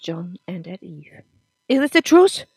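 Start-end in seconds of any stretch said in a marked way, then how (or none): 1.7-2.4 loud, high shout of disbelief; shouted question. A narrator's reading. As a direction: yell it at them, demanding the truth. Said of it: "he shouted".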